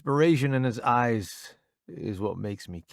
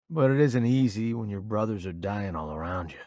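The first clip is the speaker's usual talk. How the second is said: usual speaking voice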